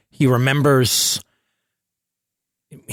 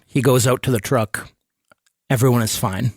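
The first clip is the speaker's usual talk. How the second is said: normal talking voice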